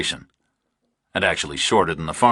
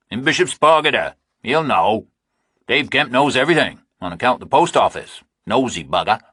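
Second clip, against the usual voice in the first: higher, louder, talking down; impatient farmer; 6 semitones higher, 4 dB louder than usual. Acted. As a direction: spell it out to an idiot. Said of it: "said impatiently, like Reacher was an idiot".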